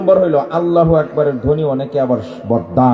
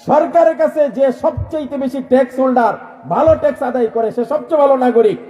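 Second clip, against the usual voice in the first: fiery preaching; 9 semitones higher than usual. Acted. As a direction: rouse the crowd with the sermon. Preaching at full force. (জোরালো ওয়াজের সুর)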